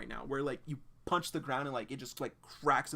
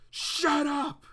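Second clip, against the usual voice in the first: high, loud shout; furious yell; 14 semitones higher, 11 dB louder than usual. Acted. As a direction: bark it at her with full force. John snapping at Claire.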